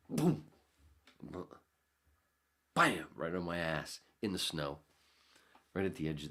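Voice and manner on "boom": high, loud "boom" for the hit; punch sound effect. Retold or imitated. Knocked down in the snow, a loud punch sound. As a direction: smack out the impact sound.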